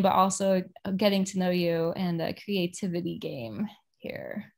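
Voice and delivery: usual speaking voice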